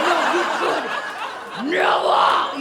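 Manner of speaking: loud, stressed "never"; loud emphatic put-down. Retold or imitated. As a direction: hammer home the "never". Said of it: "I was like".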